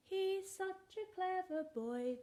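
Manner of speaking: high, slow puppet voice praising; a slow high puppet cheering the dog on; squeaky helper puppet praising. Acted.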